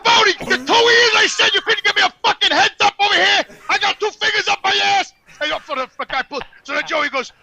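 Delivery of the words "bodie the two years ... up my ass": raised, higher, indignant shout; retold outrage, loud and high-pitched; indignant protest. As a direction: shout it like a wronged patient, playing it for laughs.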